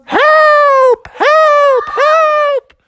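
very high, loud cries for help; screaming. Acted. Froggy stuck down the sewer, screaming for help. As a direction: shriek as if trapped far below. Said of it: "he was screaming".

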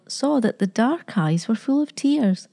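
usual speaking voice

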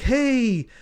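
high, stretched bar-room call; rousing welcome. Acted. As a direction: call out a treat to the whole bar, high-pitched. Bar welcome for returning man.

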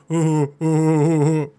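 loud drawn-out "oh" sounds; hammed-up repeated oohs; imitated effortful noise. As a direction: mimic his noise in long, loud ohs. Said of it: "he keeps going".

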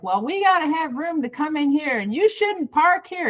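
a hammed-up man telling her off; in my face. To a woman parking by his building, a mock high scold. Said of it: "getting all in my face".

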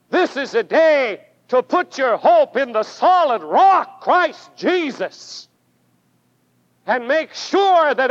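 fervent revival sermon climax; full-force preaching. Acted. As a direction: preach it hard, pushing every phrase upward.

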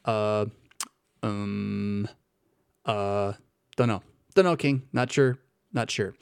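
mock-hesitant advisers with long empty pauses; hesitant, evasive. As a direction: stall with drawn-out ums and mumbled non-answers. Acting out advisers dodging the king.

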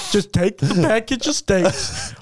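slow, coaxing put-on voice; mock-casual nudge toward mischief; sly tempter. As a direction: talk a stranger into it, drawing words out. Contractor slyly tempting shoppers to steal.

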